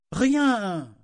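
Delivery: high, loud, drawn-out outburst; emportée. A narrator's reading. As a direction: fling the denial out angrily. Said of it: "s'emporta Malta". (dénégation aiguë et excédée)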